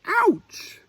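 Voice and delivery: very high, loud yelp of pain; a sharp yelp on hitting the ground; ouch cry. A narrator's reading. Little Owl landing hard on the ground, high loud yelp. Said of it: "cried Little Owl as she hit the ground".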